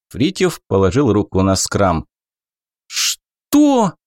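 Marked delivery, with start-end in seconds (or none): 2.9-3.9 startled goblin. Acted. Goblin alarmed at sword.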